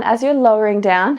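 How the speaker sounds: ordinary delivery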